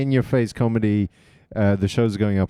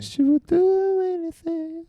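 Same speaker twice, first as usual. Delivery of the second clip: put-on squeaky spoiled teen girl, slowed down; high prissy girl voice, drawn out; high mock girly voice; slower than usual. Mocking a spoiled sixteen-year-old in a high, slow voice.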